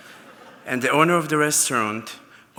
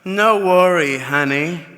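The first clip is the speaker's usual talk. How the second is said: lightly imitated Italian accent, louder start; breezy mock Italian reassurance; 3 semitones higher, 6 dB louder, slower than usual. Italian model brushing off the warning, in Italian accent. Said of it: "And in Italian accent, she said".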